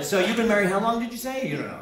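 ordinary talk

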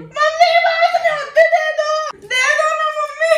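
high, loud half-laughing yell; shrieking plea. Real, not acted. Daughter screaming at mom to hand over money, laughing shriek.

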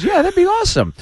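high-pitched happy retold acceptance; gush, voice way up; excited fan. Comic plays the attacker taking free tickets, high and thrilled.

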